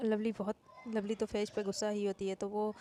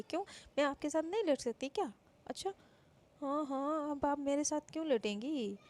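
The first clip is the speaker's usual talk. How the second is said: higher, playful teasing singsong; 5 semitones higher than usual. Acted. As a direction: tease the sister about her husband. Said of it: "अपनी दी को चिढ़ाने लगती है".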